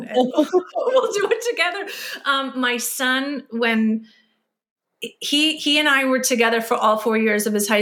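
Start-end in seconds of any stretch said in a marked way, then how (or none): 0.0-1.9 laughing through tears; a real laugh over the words, choking up a little; teary laugh. Real, not acted. Host laughing with her guest about getting emotional. Hosts tearing up, laughing together.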